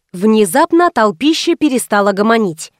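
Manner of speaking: plain reading voice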